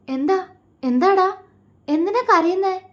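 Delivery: high, anxious pleading; worried husband seeing wife cry, alarmed. A narrator's reading. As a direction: rush to ask why she cries. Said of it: "വെപ്രാളത്തോടെ നോക്കി".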